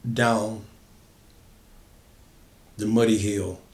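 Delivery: ordinary delivery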